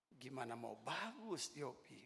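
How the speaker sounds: low, soft mock-confidential aside; retold whisper. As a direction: murmur a slow complaint, imitating the pastor. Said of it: "dia bisik sama saya".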